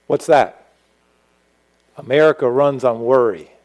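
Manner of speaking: ordinary delivery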